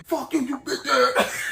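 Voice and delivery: high-pitched mock furious shout; angry outburst. College Boy cursing out Secret Weapon, mock furious. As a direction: imitate an enraged man yelling down the phone. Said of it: "Old boy get mad as hell".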